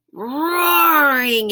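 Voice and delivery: long drawn-out loud roar on the word. Acted. Reader acting out the lion for children, roaring. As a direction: stretch roaring out like a lion's roar.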